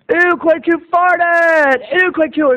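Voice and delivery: high, slow mocking chant of disgust; drawn-out schoolyard ew; class jeering. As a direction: taunt him like a whole classroom. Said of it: "and they went".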